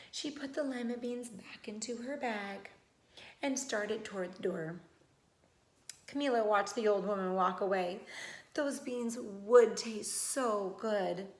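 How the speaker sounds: usual speaking voice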